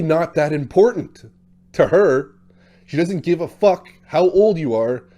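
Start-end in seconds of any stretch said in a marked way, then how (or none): none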